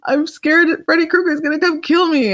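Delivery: high, frightened child voice, mimicked; scared little cousin. Cousin in the bunk bed telling her fear, imitated high and scared. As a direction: play the crying eight-year-old in the top bunk. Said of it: "She's like".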